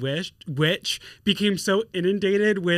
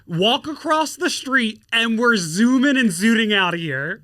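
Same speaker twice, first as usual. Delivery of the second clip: higher, louder voice of a mother giving orders; mom with game plan; 7 semitones higher, 5 dB louder than usual. Acted. Mom laying out her pickup escape plan, bossy mother. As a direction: hustle the kid along loudly. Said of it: "My mom always had that game plan. She was like".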